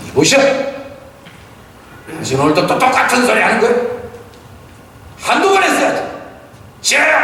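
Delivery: wife shouting at her apologizing husband; angry wife. Retold or imitated. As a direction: snap back at him like a fed-up wife. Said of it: "비슷하게 흉내는 못 냈지만은".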